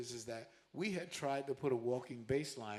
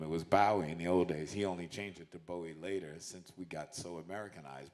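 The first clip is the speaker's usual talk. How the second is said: ordinary delivery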